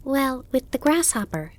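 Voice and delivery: put-on high, eager child voice; Bambi. A narrator's reading. Fawn explaining to his mother whom he met, excited. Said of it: "Bambi explained".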